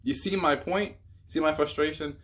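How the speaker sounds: begging viewers to see his frustration; exasperated appeal. Real, not acted.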